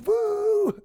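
high, drawn-out "woo"; imitated crowd cheer. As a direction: whoop like a rally crowd.

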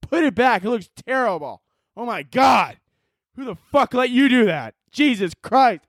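high, slow, mocking hater voice. Mocking Instagram haters over his haircut, high mock outrage. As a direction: ham up the outraged commenter. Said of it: "So many people".

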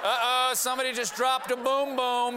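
high hammed-up guy voice; goofy uncle voice. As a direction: ham up a careless uncle. Voicing the landmine man.

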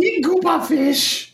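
high, slow, goofy alien voice; Jar Jar voice. Pretend. Cohost mimics Jar Jar, high and slow. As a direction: drag it out in a silly high gungan voice. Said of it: "Jar Jar, man".

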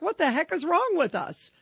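normal talking voice

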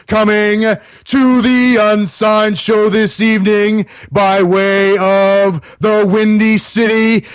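slow, drawn-out boxing ring announcer; ringmaster announcer. Acted. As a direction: stretch every word like a ring announcer. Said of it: "my version of the Michael Buffer".

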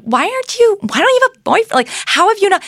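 high, prying mock voice; nosy questioner. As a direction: imitate a nosy relative. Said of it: "she often is told".

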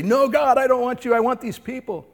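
high loud plea to God that fades; a hint of a stubborn believer; mock prayer. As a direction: voice the unspoken choice. Imagined prayer rejecting God.

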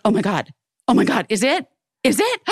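rising to a high gasp of delight; thrilled birthday surprise, replayed; mock excited. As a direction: build from disbelief to a squeal. Surprised by birthday beret gift.

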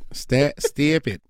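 slow, drawn-out mock voice; teasing slow imitation; mock catchphrase. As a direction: stretch it out teasingly. Mocking repeated catchphrase.

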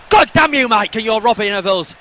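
loud hammed-up outrage; mock outrage. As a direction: fake fury, high and loud.

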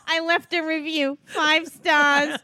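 hammed-up mom boasting; mother impression. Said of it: "I love when [name] does my voice".

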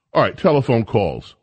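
ordinary talk

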